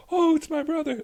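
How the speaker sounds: high, gushing stage joy; theatrical reunion. Retold or imitated. Host mocks a theatrical Sacagawea reunion, gushing high. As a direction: ham up the big reunion cry. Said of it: "they make it like she runs over".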